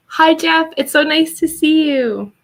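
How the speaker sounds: high, loud sing-out hello; bright greeting call. Real, not acted. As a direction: call out a delighted welcome. Calling out greeting to Jeff.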